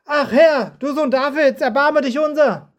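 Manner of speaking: high, loud pleading cry for mercy. Acted. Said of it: "Doch sie schrien noch viel lauter".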